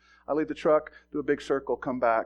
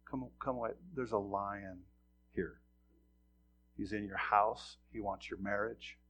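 softer, tender pleading; gentle plea; 4 semitones lower, 6 dB quieter than usual. Retold or imitated. Deacons tenderly pleading with a straying brother. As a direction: coax a brother home gently. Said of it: "the deacons have to come out and say".

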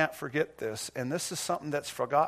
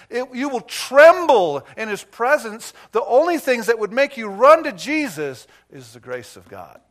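rising higher and louder into full-force preaching; building preaching; 6 semitones higher, 11 dB louder than usual. Real, not acted. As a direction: build to a thundering climax.

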